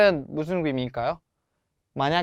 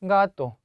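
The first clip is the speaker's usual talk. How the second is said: 가토 with a raised, stressed 가; 4 semitones higher, much slower than usual. Shown as a demonstration. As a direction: lean on the first syllable of 가토. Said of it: "아에 자연스럽게 강세를". (가토의 '가'에 강세)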